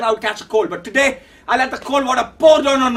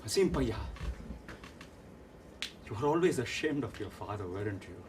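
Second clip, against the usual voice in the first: very low, hushed reproach; quiet, hurt father; 14 semitones lower, 15 dB quieter than usual. Acted. Father asking if son was ashamed of him, quietly wounded.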